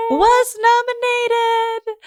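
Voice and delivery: high and slow, hammed-up; theatrical high announcement; mock dramatic. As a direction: drag it out like a scandal.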